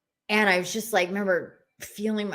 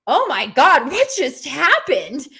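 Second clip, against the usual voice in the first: amazed; 6 semitones higher, 7 dB louder than usual. Retold or imitated. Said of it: "And I went".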